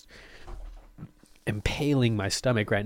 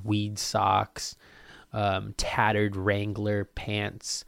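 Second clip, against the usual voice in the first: ordinary talk, nothing marked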